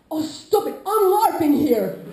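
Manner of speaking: put-on high, loud, slow childish protest; imitated annoyed kid. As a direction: play the kid brushing him off. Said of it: "the nine-year-old was like".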